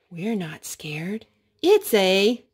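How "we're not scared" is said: hushed, lower story whisper; mock-brave whisper to the toddler. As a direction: breathe it like a secret.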